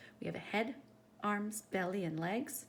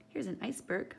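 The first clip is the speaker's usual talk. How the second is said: ordinary delivery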